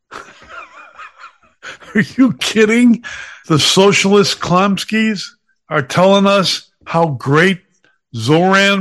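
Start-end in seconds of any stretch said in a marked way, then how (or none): 0.1-3.0 high, drawn-out disbelief; incredulous scoff. Real, not acted. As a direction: scoff with a laugh, pitch jumping up. Scoffing at today's journalists.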